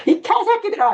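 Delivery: very high-pitched angry yell; furious cursing. Retold or imitated. Lecturer re-enacts Jesus cursing the rulers, furious high yell. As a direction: let the insult rip at a screaming pitch. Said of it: "심각하게 욕을 해요".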